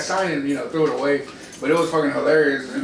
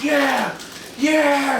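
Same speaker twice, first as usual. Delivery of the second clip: high, drawn-out party yeah; hyped retold cheer; pumped-up reply; 9 semitones higher than usual. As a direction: imitate a drinker egged on by the crowd. Drinker answering the crowd's chant.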